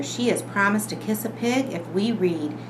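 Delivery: plain reading voice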